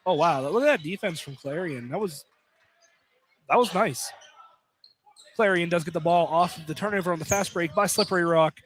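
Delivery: ordinary talk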